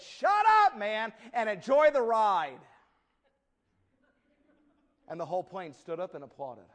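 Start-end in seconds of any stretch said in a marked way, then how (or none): 0.0-2.6 much higher, loud, slow rebuke. Retold or imitated. Passenger rebuking the loud businessman, loud high shout. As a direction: snap it across the plane cabin. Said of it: "out loud he said".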